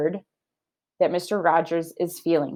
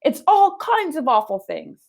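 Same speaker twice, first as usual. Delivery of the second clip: shocked Missus Rogers; 9 semitones higher than usual. Acted. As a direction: drag it out in pure disbelief. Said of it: "said Missus Rogers".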